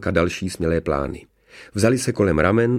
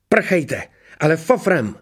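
high, raised urgent call; urgent order; 9 semitones higher, 3 dB louder, slower than usual. Acted. Telling children to flee quickly, urgent. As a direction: send them running at once.

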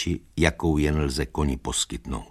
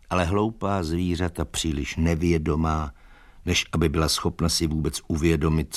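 ordinary delivery

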